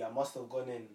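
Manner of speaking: usual speaking voice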